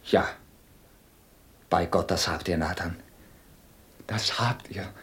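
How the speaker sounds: low, soft, near whisper at the end; moved, hushed admission. Acted. Templar is won over by Nathan, hushed and moved. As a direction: concede it quietly, deeply struck.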